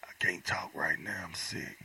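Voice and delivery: weak, whispered sick voice. Retold or imitated. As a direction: barely whisper like you're ill. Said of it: "this is what it is".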